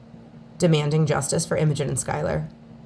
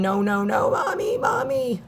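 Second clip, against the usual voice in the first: put-on children's frightened screams; 5 semitones higher than usual. Mother retells children screaming on phone.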